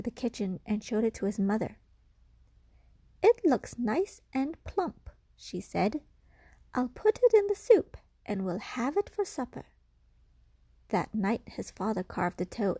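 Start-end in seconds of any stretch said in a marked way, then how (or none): 3.2-5.1 mother's voice. Acted. 6.7-9.7 mother's voice. Acted.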